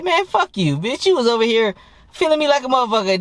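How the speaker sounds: high-pitched indignant comeback; high, mock-outraged rant at normal volume; mock telling-off. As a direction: retell a quarrel with the pitch pushed up. Acted telling-off in retold quarrel.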